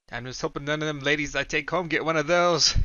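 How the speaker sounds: joking son. A narrator's reading.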